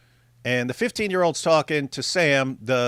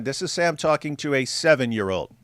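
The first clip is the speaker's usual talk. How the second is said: ordinary talk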